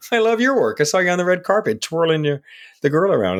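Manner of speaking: high, fast excited echo; quoted excited reply. Retold or imitated. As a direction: gush it back high and quick. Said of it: "He goes".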